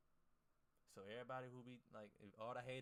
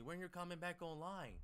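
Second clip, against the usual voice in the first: higher, louder whiny imitation of needy followers; mocking copy traders; 8 semitones higher, 6 dB louder than usual. Streamer mocking copy traders who text him, in a whiny imitation. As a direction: mimic the texters begging him to return. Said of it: "they gonna be texting me".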